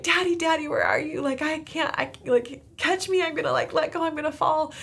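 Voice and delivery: put-on high, loud little-girl voice calling for daddy; a six-year-old panicking on the monkey bars; scared little girl.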